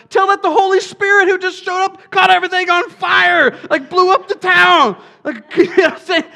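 a preacher roaring at the congregation; fiery preaching. Real, not acted. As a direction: blast it out with laughing fire. Preaching at full force.

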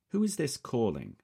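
guarded British man asking; cautious, clipped-free British query; British accent. A narrator's reading. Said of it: "The voice has a most definite British accent".

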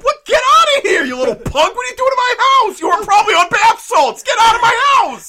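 high, loud hammed-up yelling; mock angry homeowner. Imitating an angry owner yelling at the intruding kid. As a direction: bellow like an outraged homeowner. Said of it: "Not like".

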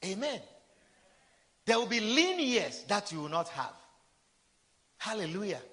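ordinary talk, nothing marked

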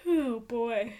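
talking through a yawn. Real, not acted. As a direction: say it mid-yawn. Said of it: "I got the yawns".

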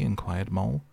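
ordinary delivery

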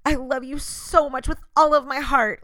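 hammed-up gushing declaration of love. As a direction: pour it on like an over-the-top admirer. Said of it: "I could easily become the person".

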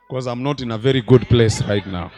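loud laugh through the words. Real, not acted. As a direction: laugh as you admit it. Laughs at his own joke.

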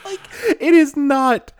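high, loud words through laughter; can barely get it out for laughing. Real, not acted. Telling her co-host about the scream, real laughter. Recalling the car-alarm scream.

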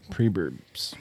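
breathy whispered echo; whispering. Real, not acted. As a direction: whisper it to yourself. Echoed under breath after group.

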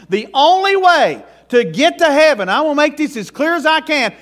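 high, loud revival preaching, pressing every line; fiery preaching. Acted. Pastor urging the congregation to accept Jesus, loud fiery preaching. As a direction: drive it home like a country preacher at full force.

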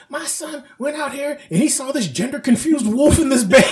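high, put-on indignant mother; Fairy Godmother. Godmother complaining about her son's discovery, high voice. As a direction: pitch it way up, breaking into laughter. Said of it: "talk to the fairy godmother".

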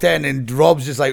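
ordinary delivery

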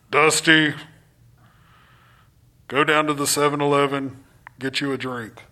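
low, booming God voice dropping away; mock voice of God. Preacher imitating God talking to Dusty, mock-solemn. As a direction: play God giving an everyday errand, low and measured. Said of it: "I've never heard God's voice".

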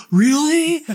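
high, loud starstruck disbelief; retold squeal of astonishment; amazed reaction. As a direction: react in thrilled disbelief, pitch shooting up. Retold amazed reaction to wife's news.

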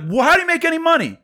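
high, loud, fast outburst; retold yelling. Rep startling the whole office from his cubicle, yelling. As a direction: snap heads with a sudden incredulous yell. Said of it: "Why were you yelling at him?".

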